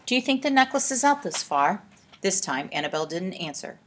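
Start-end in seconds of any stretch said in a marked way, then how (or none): 0.1-1.8 mildly anxious question, pitched high; nervous. A narrator's reading. Olive asks Annabel far out on the lake, mildly nervous. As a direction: ask with a touch of nerves. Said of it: "said Olive nervously".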